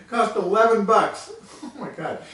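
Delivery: can't keep a straight face telling it; laughing. Real, not acted. Laughing to viewers about the eleven-dollar steak. Laughing at his own story.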